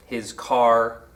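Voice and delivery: "His car" with CAR stressed, "His" light. Shown as a demonstration. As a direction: lean on "car", barely touch "His". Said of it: "car accented, not his".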